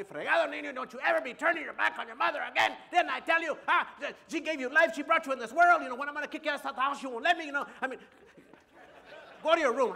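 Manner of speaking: high, loud angry scolding; his father yelling at him for disrespecting his mother. Retold or imitated. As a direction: tear into the boy. Father scolding son, quoted.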